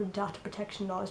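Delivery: ordinary delivery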